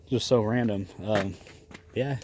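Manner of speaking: normal talking voice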